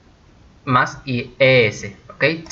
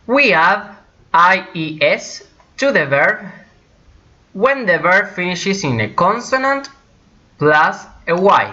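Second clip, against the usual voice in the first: slow and higher, phrase by phrase; deliberate, raised teaching voice; slow rule announcement; 5 semitones higher, slower than usual. Shown as a demonstration. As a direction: lay out the rule for learners.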